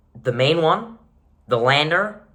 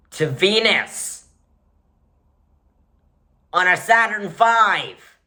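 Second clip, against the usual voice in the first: slow, louder disbelief; incredulous; 7 semitones higher, 5 dB louder, slower than usual. Real, not acted. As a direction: spell out the absurd plan. Disbelieving emphasis.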